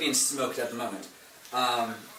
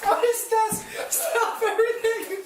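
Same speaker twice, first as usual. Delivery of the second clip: high laughing shriek; shrieking with laughter; slower than usual. Real, not acted. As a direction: squeal through laughter. Flour fiasco, shrieking laugh.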